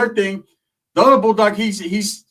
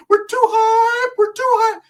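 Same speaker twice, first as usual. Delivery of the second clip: squeaky high-pitched mock voice; 3 dB louder, slower than usual. Comic mocking friends' old style in a high squeak.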